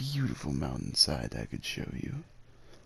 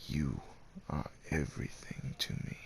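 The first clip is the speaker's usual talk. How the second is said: soft, breathy admission, much softer; tender near-whisper; 8 dB quieter than usual. Acted. Caretaker confessing his feelings to the listener, hushed and tender. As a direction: confess it almost under your breath.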